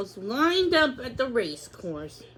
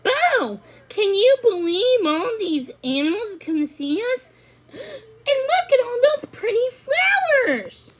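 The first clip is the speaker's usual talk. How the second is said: high, excited filly voice; cheerful young horse; 11 semitones higher than usual. A narrator's reading. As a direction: gush about everything like a happy young horse. She smiled and said.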